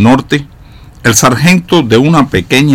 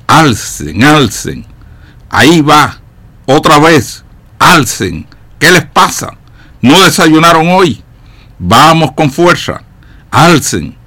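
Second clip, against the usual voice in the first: moderately raised, higher voice for a sergeant's shouted orders; sargento gritando órdenes; 4 semitones higher, 5 dB louder, slower than usual. A narrator's reading. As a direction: call out commands like an impatient sergeant, a mild narrated shout. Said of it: "se oía a menudo gritando". (sargento dando órdenes, voz alzada sin gritar del todo)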